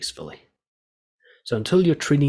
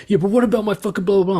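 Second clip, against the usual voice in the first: mock hostile outburst, higher and louder; angry offender; 9 semitones higher, 7 dB louder, faster than usual. Offender snapping back at the counsellor, a hammed-up angry retort. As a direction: bark it like an irritated inmate brushing you off. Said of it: "They'll be like".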